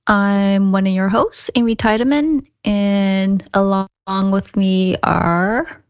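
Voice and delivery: ordinary talk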